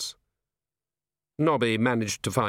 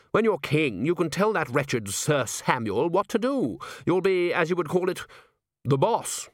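raised, lofty coaxing; persuasive courtier; 6 semitones higher than usual. Acted. A chair sells kingship to Nobby, coaxing. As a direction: tempt him with careful condescension.

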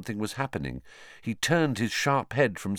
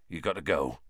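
quiet urging; 5 semitones lower, 5 dB quieter, faster than usual. A narrator's reading. Hagrid trembling, urging.

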